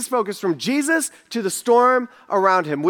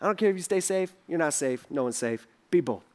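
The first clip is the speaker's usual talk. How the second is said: soft fatherly send-off; 5 semitones lower, 7 dB quieter than usual. Retold or imitated. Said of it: "Sometimes I tell them".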